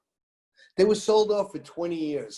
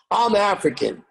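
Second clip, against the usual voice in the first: a loud, indignant retold yell, pitched higher; 4 semitones higher, 6 dB louder than usual. Singer yells at the promoter that she is African, loud and indignant. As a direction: throw it back at him as a loud correction. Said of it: "And she yelled at me".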